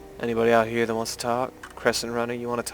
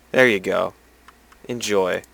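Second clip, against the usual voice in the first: usual speaking voice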